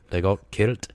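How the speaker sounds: slow amateur Scottish accent. Shown as a demonstration. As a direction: land the punchline slowly in mock Scottish. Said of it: "how do you say it in the Scottish?".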